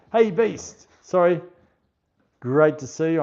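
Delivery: high, loud, delighted hello; excited greeting. Real, not acted. Streamer greeting a regular viewer in chat, loud and excited. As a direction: call out brightly to a friend just spotted.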